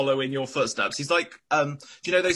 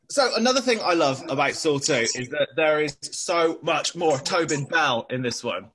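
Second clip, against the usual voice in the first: usual speaking voice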